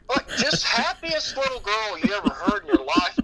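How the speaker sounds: high, loud, laughing. Real, not acted. Father laughing about his cheerful daughter. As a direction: gush it out laughing.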